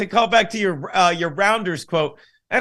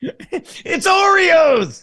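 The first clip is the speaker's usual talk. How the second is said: high, drawn-out mock wail; exasperated shout; 8 semitones higher, 4 dB louder, much slower than usual. Mocking the movie's silly tell, exasperated shout. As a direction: cry it out in comic disbelief.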